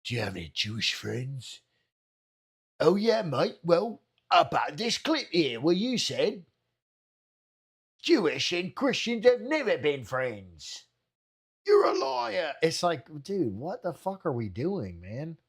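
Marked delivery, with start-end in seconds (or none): 0.0-1.6 a lower, softer mock of the interviewer; mocking Piers. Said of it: "he's like". 2.8-12.5 mocking Piers. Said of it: "he's like".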